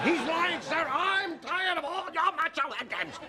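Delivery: high, desperate outburst; slow, high-pitched wail of protest; frantic protest. Acted. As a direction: cry out to be believed by the boss. Employee frantically contradicts confession to boss.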